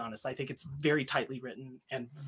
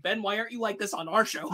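mock complaint; 7 semitones higher than usual. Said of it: "Ryan basically coming out and saying".

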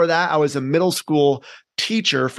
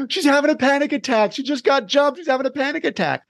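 much higher, breathless alarmed rush; panicked friend; 9 semitones higher than usual. Retold or imitated. Friend reporting the panic attack to the teacher, high and alarmed. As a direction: blurt it out like a scared kid after a gasp. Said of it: "her friend's like".

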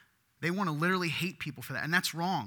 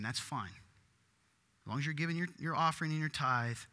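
ordinary talk

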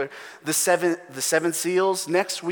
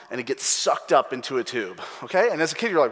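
ordinary delivery

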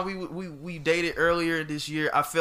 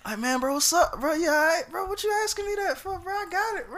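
high, rising mock innocence; dodgy debtor voice; 13 semitones higher than usual. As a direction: play the debtor who won't pay up. Imitating a debtor dodging payment.

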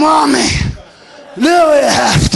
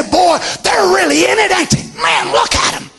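mock fan excitement; 6 semitones higher than usual. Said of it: "look at them and say".

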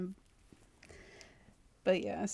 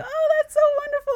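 very high, louder delighted mom voice; hammed-up gushing mom; 8 dB louder than usual. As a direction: coo it like a thrilled mother. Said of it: "she's like".

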